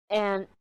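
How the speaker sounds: breathy exasperated sigh, no voice; whispered sigh. Real, not acted. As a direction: let the air out, fed up. Frustrated about no privacy.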